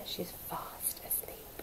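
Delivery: slow, very soft whisper; hush, the snurd sleeps. A narrator's reading. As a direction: hen whispering to the thieves. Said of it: "chipped in the hen".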